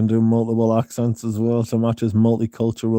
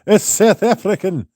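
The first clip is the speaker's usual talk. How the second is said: put-on high, amused South African accent; lifted, hammed-up South African; mock South African; 9 semitones higher, 4 dB louder than usual. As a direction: exaggerate the South African vowels. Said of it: "The South African attempt is killing me".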